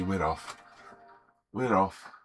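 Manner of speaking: normal talking voice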